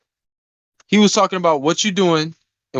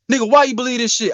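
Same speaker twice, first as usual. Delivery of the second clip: friend's rant, fast; 6 semitones higher, faster than usual. Retold or imitated. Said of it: "He's like".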